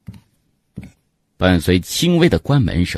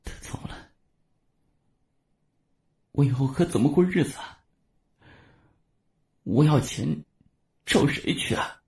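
mumbling; 9 semitones higher, 7 dB quieter than usual. A narrator's reading. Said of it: "喃喃回道".

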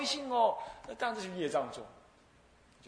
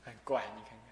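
ordinary delivery

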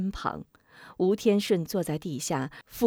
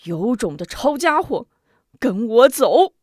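loud call to arms, louder at the end; rallying shout; 3 semitones higher, 8 dB louder than usual. Acted. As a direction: rouse the men to fight. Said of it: "向人们一招手". (振臂高呼)

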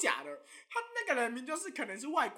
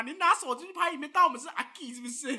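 ordinary talk

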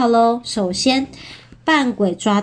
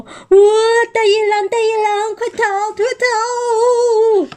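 high squealing piglet alarm; storybook fright, pitched way up; mock panic; 11 semitones higher, much faster than usual. Panicked piglets flee from wolves.

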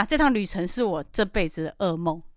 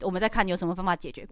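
ordinary delivery

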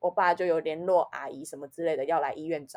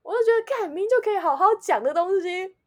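higher and louder, laughing in outrage; indignant venting; 12 semitones higher, 9 dB louder than usual. Real, not acted. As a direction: rant about being scolded unfairly. Venting about the harsh police officer. (对警察凶她愤愤不平，音高声大，夹着笑)

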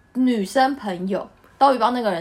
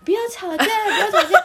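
put-on drunk pleading; 9 semitones higher, 3 dB louder than usual. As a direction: mimic the drunk friend, laughing. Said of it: "这个男生就这样". (笑着模仿醉汉，声音高而慢)

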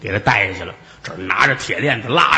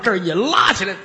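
usual speaking voice

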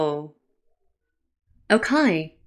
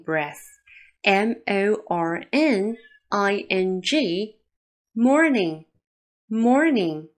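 slow spelling out; much slower than usual. Shown as a demonstration. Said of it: "spelling out the word slowly".

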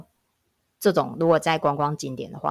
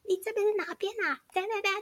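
shrill-sounding outburst acted out in a squeaky high register; imitating a yelling husband; 4 dB quieter than usual. Angry husband grabbing the phone, high mock shouting. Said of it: "一接过去开始大吼".

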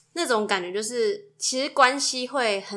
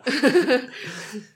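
louder burst of laughing; laughter; 3 semitones higher, 4 dB louder than usual. Real, not acted. Wife amused by his bedroom remark, laughing. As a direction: crack up out loud.

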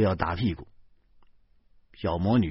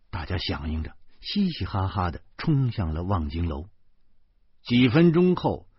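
plain reading voice

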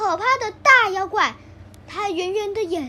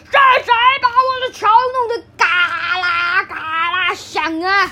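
loud, slow roaring troll voice; 7 dB louder than usual. A narrator's reading. Furious troll roars at the big goat. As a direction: roar it out with all your might. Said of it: "大妖怪用大声的吼道".

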